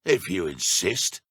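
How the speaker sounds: ordinary talk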